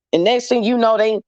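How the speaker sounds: ordinary delivery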